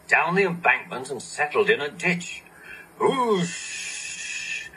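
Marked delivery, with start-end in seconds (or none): 3.0-4.7 breathy rushing whoosh; unvoiced hissing whoosh; steam hiss. A narrator's reading. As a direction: let the steam rush out. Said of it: "he hissed".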